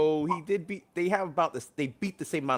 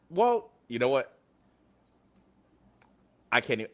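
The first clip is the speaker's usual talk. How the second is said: ordinary delivery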